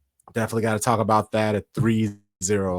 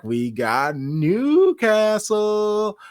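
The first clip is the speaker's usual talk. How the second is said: drawn out slow and much higher; hyped announcement; 11 semitones higher, much slower than usual. Real, not acted. Host hyping the Newcastle game to listeners, slow high excitement. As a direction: savor the name like a big reveal.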